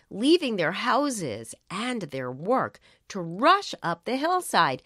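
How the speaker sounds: ordinary delivery, nothing marked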